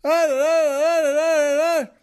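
loud, high gibberish tirade. Retold or imitated. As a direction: fire back a mock-furious string of nonsense. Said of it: "even louder and angrier".

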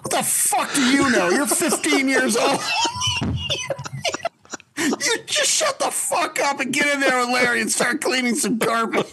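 a hammed-up replay of yelling at a fifteen-year-old; angry outburst. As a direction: bark it at him like you're furious again. Angry retold outburst at teen.

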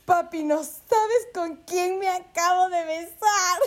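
high, slow, gushing tipsy voice; tipsy girl squealing the news to her father; excited drunk quote. Retold or imitated. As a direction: gush it out to your dad, half crying with glee. Drunk girl telling dad.

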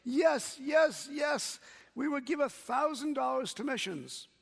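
put-on high, loud, eager children's shout; Sunday school kids shouting yes to their teacher, excited; kids cheering. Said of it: "start cheering and shouting".

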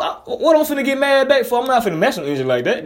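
usual speaking voice

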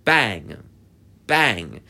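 loud, separate bangs; imitated banging. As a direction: punch out each bang as its own noise. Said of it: "then I am hearing bang".

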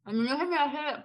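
talking with a mouth full of food. Real, not acted. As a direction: speak around a bite of food. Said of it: "perdón, estoy comiendo".

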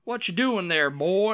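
hammed-up American Southern caricature; Deep South accent. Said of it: "they go really southern".

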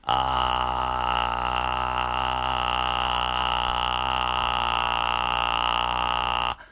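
long low creaky ah; low sustained fry on あー; vocal fry. Shown as a demonstration. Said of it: "低い音で".

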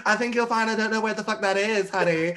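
high, loud, camp put-down; sassy comeback. Retold or imitated. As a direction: toss it off with mock sweetness. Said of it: "And I'm like".

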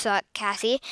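usual speaking voice

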